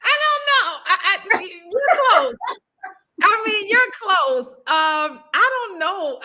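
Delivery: high laughter while answering; laughing. Real, not acted. Guest amused by host's accent attempt, laughing. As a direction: laugh through the reply.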